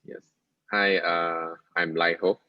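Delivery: ordinary talk, nothing marked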